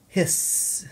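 long sibilant "hisss"; snake hiss. Pretend. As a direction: draw out the s like a snake. Snake in picture book.

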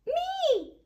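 high eager squeal; excited cry. A narrator's reading. Peppa volunteering first to count, excited high cry. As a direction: shout it out, thrilled. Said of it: "cried Peppa excitedly".

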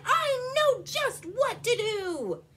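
high, loud, slow proclamation; shouting. A narrator's reading. Stu announces his idea, excited shout. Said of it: "he shouted".

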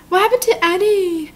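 high-pitched, dismayed question; alarmed worry. Real, not acted. Reader worried about what happened to Annie, high alarm.

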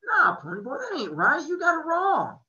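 you can't believe what you just heard; dumbfounded disbelief. A narrator's reading. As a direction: friend protesting in loud disbelief.

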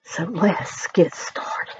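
slow hushed whisper; whisper, drawn out. Real, not acted. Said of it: "I'm not supposed to do that".

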